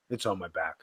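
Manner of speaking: a private groan to himself; under-the-breath echo. Shown as a demonstration. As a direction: drop it to a near-whisper aside. Repeats the phrase, second much softer.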